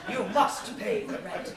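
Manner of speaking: mustache twirling villain. Acted. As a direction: lean into the villain's threat.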